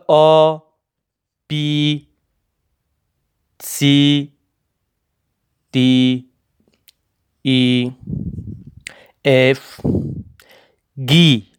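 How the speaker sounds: letters آ to گی named the German way, one by one; German alphabet demo, آ to گی with long pauses; German letter names. Shown as a demonstration. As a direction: pronounce آ، بی، سی، دی، ای، اف، گی as separate, held German letters.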